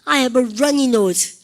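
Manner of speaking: slow, loud model sentence; teacher drilling slowly; slow model phrase. Shown as a demonstration. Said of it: "시작".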